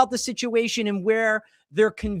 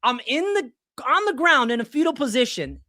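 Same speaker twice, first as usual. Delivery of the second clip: hammed-up distraught plea to his wife; mock despairing whine; 6 semitones higher than usual. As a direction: whine it like a man curled up on the floor. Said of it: "I'm like".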